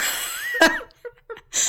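squealing laugh; high burst of laughter. Real, not acted. Laughs at own joke.

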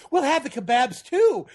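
sheepish starstruck echo. Retold or imitated. Starstruck copying Connery's order.

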